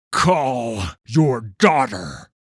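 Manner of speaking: loud, slow barked command; harsh order. Acted. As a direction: bark it slowly at the farmer. Vannis orders the farmer.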